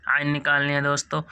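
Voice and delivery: ordinary talk, nothing marked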